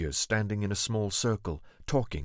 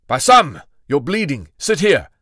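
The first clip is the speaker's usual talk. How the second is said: sharp, louder commanding snap; snapping; 5 semitones higher, 10 dB louder than usual. A narrator's reading. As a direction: bark the order at the wounded man. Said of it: "Malik snapped".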